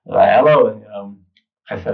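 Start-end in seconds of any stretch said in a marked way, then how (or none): 0.3-0.6 a loud, gruff one-word hello; blunt hello. Retold or imitated. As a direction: snap hello like a no-nonsense old lady. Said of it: "raspy sounding older lady with a thick Midwestern accent".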